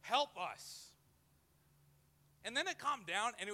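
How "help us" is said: mock panicked prayer. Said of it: "grabbed the seat handles and said".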